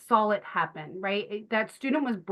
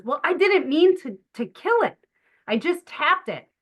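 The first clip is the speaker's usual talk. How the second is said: high, loud, defensive teacher protesting; a teacher caught out, pleading innocence up high; flustered teacher; 7 semitones higher, 4 dB louder than usual. Retold or imitated. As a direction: squeak out an embarrassed excuse. Teacher defending herself to kids.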